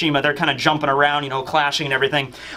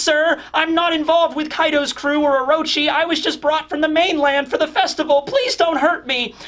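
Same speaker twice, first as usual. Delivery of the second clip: high pleading woman's voice; 12 semitones higher than usual. Retold or imitated. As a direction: beg for your life in a high voice. Woman begs Sanji for mercy.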